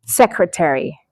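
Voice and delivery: "secretary" as SEK-ruh-teh-ree; four clear syllables in "secretary"; correct pronunciation. Shown as a demonstration. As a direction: repeat "secretary" carefully with both r's. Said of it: "not secretary, it's secretary".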